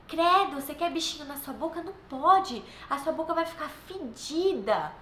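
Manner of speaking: hammed-up "credo" with playful alarm; teasing mother pretending to be shocked; mock disgust. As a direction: gross the kid out for fun. Playfully scaring child to brush.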